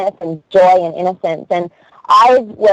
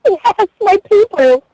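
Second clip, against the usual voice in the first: very high, slow, drawn-out delight; elated relief; 13 semitones higher, slower than usual. Acted. Newcomer finding fellow comedians who trade insults, elated. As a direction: stretch it out high in mock rapture. Acted joy at finding her crowd.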